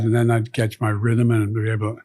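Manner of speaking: ordinary talk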